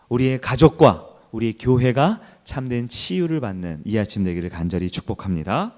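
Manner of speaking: ordinary talk, nothing marked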